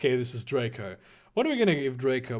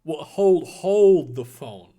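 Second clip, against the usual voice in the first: usual speaking voice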